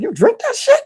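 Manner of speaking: high, disbelieving squeak; incredulous. Retold or imitated. He sees girlfriend's beers, high and incredulous.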